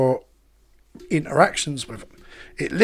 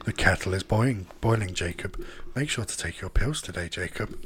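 low, soft, eerie machine voice; a calm sinister home assistant; creepy AI voice; 8 semitones lower, 8 dB quieter than usual. Acted.

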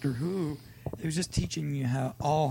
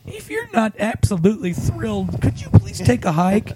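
exaggerated loud scold; loud mock scolding; 8 semitones higher, 7 dB louder than usual. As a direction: belt it like a smug shush. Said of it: "They're like".

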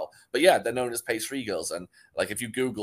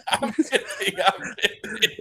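higher, laughing, half-breathed; 11 semitones higher, 5 dB louder, slower than usual. Real, not acted. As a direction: take it back through laughter. Laughing retraction of a joke.

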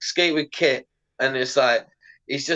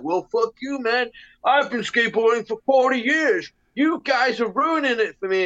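higher mock complaint; mocking grumpy veteran; 10 semitones higher than usual. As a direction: parody an offended old skater. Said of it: "It's like".

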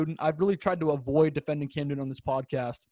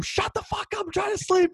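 mock yell; 13 semitones higher than usual. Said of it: "My dad's screaming from the other room".

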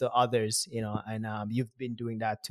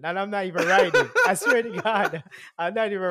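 laughing; 6 semitones higher, 7 dB louder than usual. Real, not acted. Laughing at own habit.

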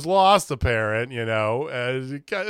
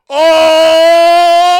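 long, loud, high "oh" of a shocked crowd; imitated crowd reaction; 14 dB louder than usual. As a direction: belt out a drawn-out crowd "oooh!". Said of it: "And the crowd just like".

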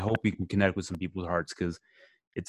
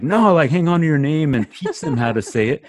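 imagined proud father; 6 semitones higher, 5 dB louder than usual. Pretend. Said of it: "I wish he would have said".

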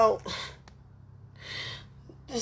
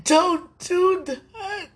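crying; 6 semitones higher, much slower than usual. Real, not acted. Grieving plea to God.

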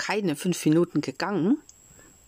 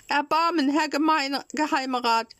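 tearful pleading; 10 semitones higher than usual. A narrator's reading. Puppet pleading with the director, high and tearful. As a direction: beg once more, voice high. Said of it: "weinte und flehte".

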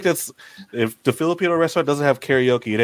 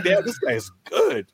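impressed; 9 semitones higher, slower than usual. Retold or imitated. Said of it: "I was like".

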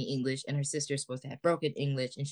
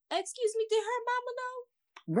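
shocked disbelief. Real, not acted. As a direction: squeal it like an aghast auntie. Shocked high exclamation.

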